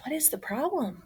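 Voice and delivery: hushed worried question; worried whisper to the listener; whispered worry. A narrator's reading. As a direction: lean in and whisper it.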